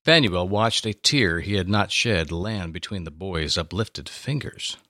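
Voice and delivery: normal talking voice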